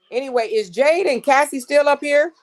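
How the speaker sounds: loud high call to the guests. Real, not acted. Checking whether her guests are still on the live, loud high call.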